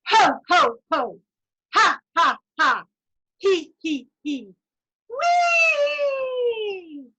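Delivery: loud ho ho, ha ha, he he, then a high whee; laughter sequence. Shown as a demonstration. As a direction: climb from belly to throat and fling out the whee. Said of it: "One more time".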